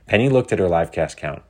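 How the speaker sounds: ordinary delivery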